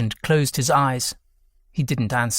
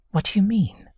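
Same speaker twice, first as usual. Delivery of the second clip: whispery, high-pitched question; hushed puzzlement; 8 semitones higher than usual. A narrator's reading. Son questions mother's confession, hushed and baffled. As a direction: ask it in a puzzled near-whisper.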